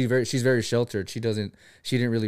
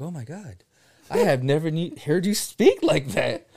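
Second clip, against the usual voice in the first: retold amazement; 4 semitones higher, slower than usual. Acts out his amazed reaction.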